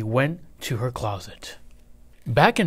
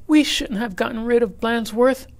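put-on higher, louder whiny kid voice; regretful kids; 10 semitones higher, 3 dB louder than usual. A narrator's reading. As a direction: kids sorry they did it.

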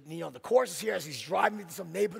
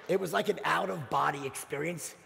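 ordinary talk, nothing marked

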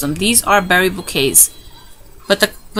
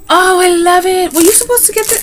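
high, loud and delighted; can't believe your luck; excited gushing; 9 semitones higher, 4 dB louder than usual. Real, not acted. Vlogger thrilled by a kit she didn't expect, gushing.

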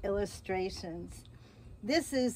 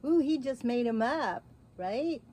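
ordinary delivery, nothing marked

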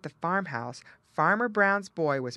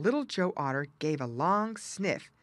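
usual speaking voice

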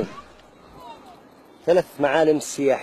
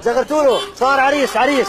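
festive calling out; 8 semitones higher than usual. Acted. Calls for wedding ululation.

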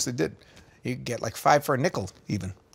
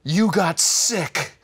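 scheming brother; 4 semitones higher, 3 dB louder, much slower than usual. Retold or imitated. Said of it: "He's like".

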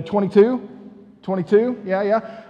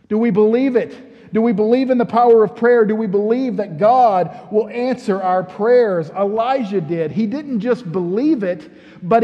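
rising preacher's challenge, louder; preaching; 3 semitones higher, 4 dB louder than usual. Real, not acted. Pastor challenging the church on prayer, preaching. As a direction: press the church hard with each question.

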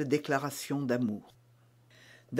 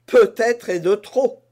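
smiling, louder and higher playful answer; smiling reply; 5 semitones higher, 8 dB louder than usual. Acted. D'Artagnan to Constance about his money, smiling. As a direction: say it with a grin, teasing. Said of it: "dit D'Artagnan en souriant".

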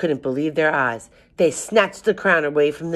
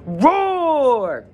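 loud high roar, falling away; lion roar; 11 semitones higher, 5 dB louder than usual. A narrator's reading. Lion jumps out to scare the old lady, roaring. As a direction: let out a long storybook lion roar. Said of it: "the lion jumped up".

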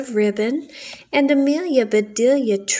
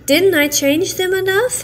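innocent maid; 8 semitones higher, 4 dB louder than usual. Acted. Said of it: "asked Amelia Bedelia".

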